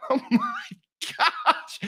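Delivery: high, breathless laughing exclamation; amazed laughter. Retold or imitated. Todd hearing they could come tonight, laughing in amazement. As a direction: laugh through the words in disbelief. Reacting to tonight's surprise visit.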